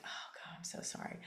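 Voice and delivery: whispered, breathless "oh God, I'm so sorry"; mother whispering sorry to her son; whispered apology. Retold or imitated. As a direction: hush it like a guilty confession.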